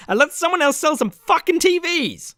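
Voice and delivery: high-pitched, fed-up outburst; exasperated climax. Real, not acted. Ending the Best Buy rebuttal, exasperated outburst. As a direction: hit the rant's peak in high exasperation.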